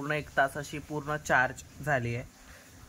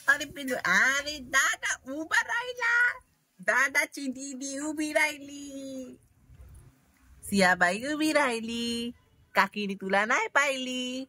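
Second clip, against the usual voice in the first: delighted sing-song; 8 semitones higher, slower than usual. Real, not acted. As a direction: cheer her first stand. Baby stands up, cheering. (बाळ उभं राहिल्यावर आनंदाने, हळू, उंच सुरात कौतुक)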